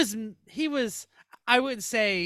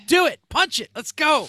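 urgent mock yell; 6 semitones higher, 7 dB louder than usual. Urging driver in retold scene.

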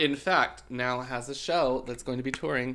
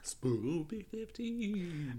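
spooky voice; 7 semitones higher, 6 dB quieter, much slower than usual. Pretend. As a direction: drag it out like a ghost. Echoing promo code spooky.

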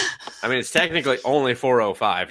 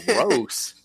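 mock disgust; 7 semitones higher than usual. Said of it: "everyone's just kinda like".

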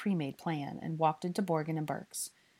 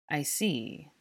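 usual speaking voice

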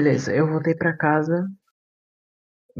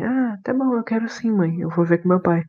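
bright, raised pitch, delighted; high happy voice; 5 semitones higher than usual. Retold or imitated. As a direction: voice goes high with joy. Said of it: "Você falou com essa voz mesmo?".

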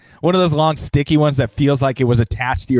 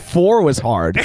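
usual speaking voice

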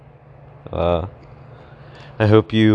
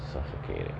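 faint choked wheeze, much lower and softer; in a headlock; 13 semitones lower, 13 dB quieter than usual. Pretend. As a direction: squeeze the word out as the air runs out. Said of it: "I can't breathe".

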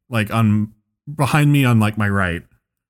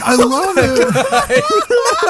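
high, stretched-out mock gushing; excited fan; much slower than usual. Fursuiter gushing about the show, imitated excitement. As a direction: squeal it slowly like a thrilled fan. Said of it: "he just went".

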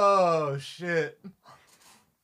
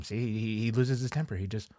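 normal talking voice

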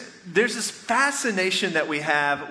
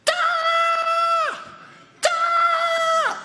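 put-on high, loud little-boy yell at each wave; son's shout against the waves, high and loud; kid's battle shout; 3 dB louder than usual. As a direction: bark it out like a small boy bracing against a wave. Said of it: "he goes".

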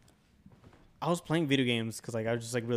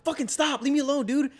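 scared pleading; 11 semitones higher, 5 dB louder than usual. Retold or imitated. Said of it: "I was like".

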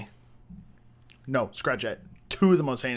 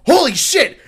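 high, loud shout of alarm; startled yell; 13 semitones higher, 13 dB louder than usual. Retold or imitated. Kid seeing him slide under gate, alarmed yell. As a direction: yelp it like someone suddenly chased. Fleeing kid's startled cry, retold.

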